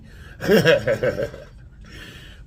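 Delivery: warm, easy laugh at himself; amused laugh. Real, not acted. Husband laughing at his wife's retort, an easy laugh.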